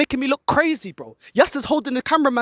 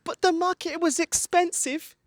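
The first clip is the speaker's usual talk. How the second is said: higher, slow mocking whine; a hint of a whiny club excuse; 6 semitones higher, slower than usual. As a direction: mimic the club's excuse slowly. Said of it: "present this as".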